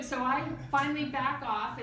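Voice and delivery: ordinary delivery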